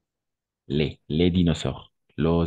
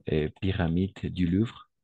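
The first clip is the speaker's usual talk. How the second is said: ordinary talk, nothing marked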